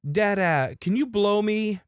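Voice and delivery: put-on toddler yell. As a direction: imitate a small boy calling out to dad. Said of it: "in his biggest toddler yell shouts".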